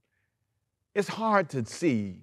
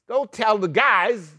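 put-on slow, higher, sly tattling girl; lightly voiced sly servant girl; servant girl whispering gossip; 6 semitones higher, 5 dB louder, slower than usual. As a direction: drag it out, higher, like a girl telling tales. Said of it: "She said to some guys".

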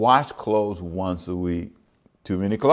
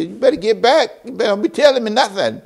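much higher, indignant voice; mock defensive neighbor; 12 semitones higher, 5 dB louder than usual. Neighbor imitated telling a pew-mate to back off, mock indignant. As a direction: warn them off in a put-on voice. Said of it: "They say".

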